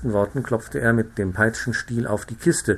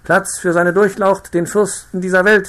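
servants calling out; 9 semitones higher, 7 dB louder than usual. Acted. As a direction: announce his highness to the whole road. Servants clearing the road.

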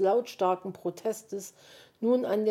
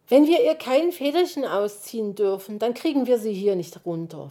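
ordinary delivery, nothing marked

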